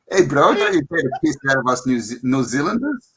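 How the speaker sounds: mock-offended New Zealand accent; indignant Kiwi bro. Shown as a demonstration. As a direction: protest in a broad Kiwi accent. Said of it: "can you do New Zealand?".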